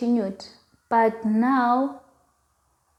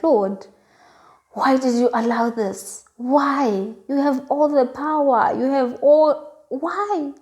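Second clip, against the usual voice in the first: retold angry crying prayer; 4 semitones higher than usual. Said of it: "I'm just crying because I'm mad".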